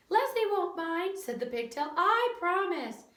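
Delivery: high, slow promising little voice; pigtail voice. A narrator's reading. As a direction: swear sweetly.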